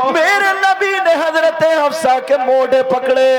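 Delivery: loud, high, impassioned sermon delivery; full force preaching. Acted. Preacher tells the crowd the Prophet's story, at full force.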